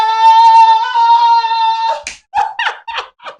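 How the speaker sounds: high, loud, singsong laugh; loud delighted laugh. Real, not acted. Host enjoying the dance break, loud laughing.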